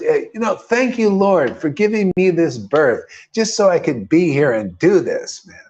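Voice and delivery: ordinary delivery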